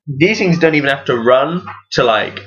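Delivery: normal talking voice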